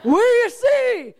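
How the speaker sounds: high, drawn-out bellowed question; very loud. Retold or imitated. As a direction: bellow the question in retold exasperation. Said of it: "he said it so loud".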